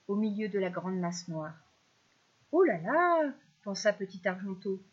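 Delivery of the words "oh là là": higher, louder, drawn swoop of astonishment; astonished fish. A narrator's reading. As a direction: let the little fish marvel. Little fish reacts to strange discovery.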